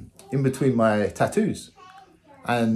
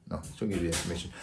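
much softer, fast muttered aside; hushed aside; 8 semitones lower, 12 dB quieter, much faster than usual. Real, not acted. Catching himself after a fighting tip, soft fast aside. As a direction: drop it under your breath like you said too much.

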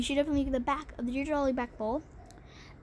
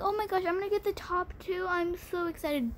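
mock excitement; 7 semitones higher than usual. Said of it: "You're gonna think".

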